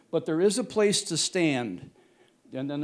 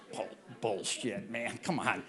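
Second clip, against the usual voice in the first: cousin's voice; 7 semitones lower, 10 dB quieter than usual. Retold or imitated.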